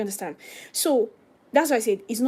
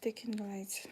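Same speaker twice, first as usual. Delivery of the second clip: soft whispered aside; whispered note about the lighting; 12 dB quieter than usual. Real, not acted. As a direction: mutter it under your breath while busy. Muttered aside while adjusting.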